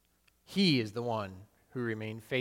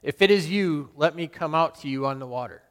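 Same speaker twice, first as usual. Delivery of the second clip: calling out high and loud across the water; eager loud call to the figure on the waves; bold cry; 4 semitones higher, 7 dB louder than usual. Retold or imitated. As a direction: shout it as a daring challenge. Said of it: "I cried out".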